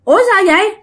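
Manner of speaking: put-on a lost young spirit waking up puzzled; a spirit speaking through Thuận, high and bewildered; possessed boy's voice. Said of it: "Lúc đó giọng Thuận trở về bình thường".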